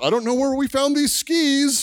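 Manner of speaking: high-pitched, playful parent voice; parents feigning surprise. Retold or imitated. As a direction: put on a high voice and play innocent about a surprise gift. Parents' mock surprise reveal of gift.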